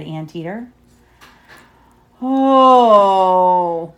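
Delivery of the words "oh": loud, drawn-out ghostly "Oh"; hammed-up spooky groan; monster moan. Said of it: "it cried".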